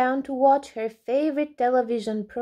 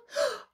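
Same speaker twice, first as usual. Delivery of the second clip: sharp gasp; 5 dB quieter than usual. A narrator's reading. Alby hears his sister.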